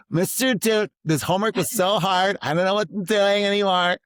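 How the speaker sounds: high, loud melodramatic student wail; mock whiny student. Student to teacher Mister Dude, hammed-up high whine. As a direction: play the overwhelmed kid, hamming up the despair. Student whining about homework again.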